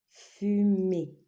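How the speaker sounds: "Fumée" in two long syllables. Shown as a demonstration. Teacher repeating a picture word, syllable by syllable. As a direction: separate FU from MÉE.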